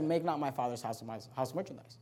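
low, soft and grave; hushed scripture. Acted. Pastor voicing angry Jesus in the temple, low and hushed. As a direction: let the verse sink almost to a murmur.

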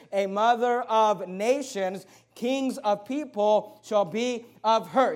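"a mother of nations" slow and deliberate; slow down and lean on "a mother of nations"; emphasis on mother of nations. Shown as a demonstration. Preacher reads verse slowly for emphasis. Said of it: "notice the emphasis".

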